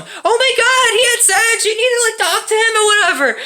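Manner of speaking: very high mock wail; distraught mom. Mom phoning dad about son, mock hysterics. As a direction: mimic a hysterical mother in a squeal. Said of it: "She calls my dad and tells him".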